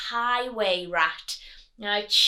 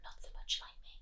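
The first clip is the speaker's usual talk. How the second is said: hushed whisper for effect; 16 dB quieter, faster than usual. Shown as a demonstration. Teacher whispering the rat's teeth line to children. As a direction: breathe it out in a whisper. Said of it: "unvoiced whisper".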